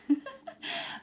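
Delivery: short unvoiced giggle, rising high; a quick airy laugh at herself; high breathy laugh. Real, not acted. Joking with viewers about shower time, high airy giggle. Laughing at her own quip.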